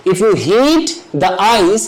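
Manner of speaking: very slow, each word spelled out for students; slow demo sentence. Shown as a demonstration. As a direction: say "if you heat the ice" slowly, leaning on heat. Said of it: "हम will नहीं लगाएंगे". (छात्रों के लिए बहुत धीरे, heat पर ज़ोर देकर)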